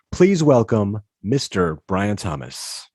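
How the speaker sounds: ordinary talk, nothing marked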